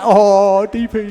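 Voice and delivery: mocking imitation. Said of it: "And he's like".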